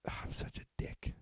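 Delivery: guilty whisper. Real, not acted. Self-reproach aside to listener.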